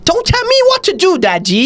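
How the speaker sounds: very high, bratty British child impression; hammed-up British accent, high-pitched; mock British accent. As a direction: talk back in a squeaky British kid voice. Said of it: "yeah, in the accent".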